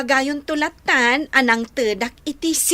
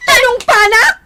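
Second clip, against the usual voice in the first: angry yell; 6 semitones higher, 7 dB louder than usual. Acted. Carol quarrels with Bete.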